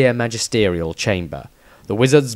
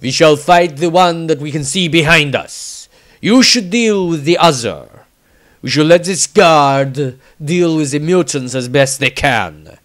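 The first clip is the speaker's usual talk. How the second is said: louder, proud noble voice; Bretonnian knight; 4 semitones higher, 5 dB louder than usual. Acted. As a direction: announce it like a gallant knight. Bretonnian man continues.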